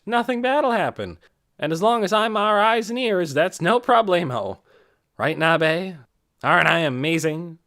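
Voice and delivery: jokey bragging. Acted. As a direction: boast like the party clown. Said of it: "he called out to them in his jokey way".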